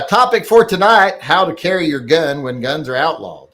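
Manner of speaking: headline read. Acted.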